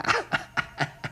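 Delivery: airy high-pitched giggle; a quick unvoiced snicker; breathless high laugh. Schemer laughing at his own plan, breathy giggle.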